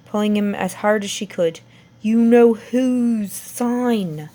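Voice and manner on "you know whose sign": slow questioning voice. Acted. Ron asks, slow and far.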